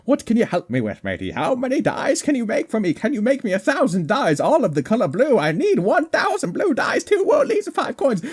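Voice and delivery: high, excited customer voice; mock customer voice. Player ordering blue dies from the shop, in a high excited voice.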